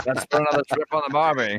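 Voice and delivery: quick, hammed-up Aussie catchphrase; mock Australian accent. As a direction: rattle it off like a movie Aussie. Joking Australian catchphrase, fast.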